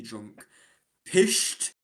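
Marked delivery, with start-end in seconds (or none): 1.1-1.7 a broad Scottish accent, punchy and higher; Scottish accent with a strong aspirated ph. Shown as a demonstration. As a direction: say it like a Scot, leaning in. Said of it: "That is pure Scottish".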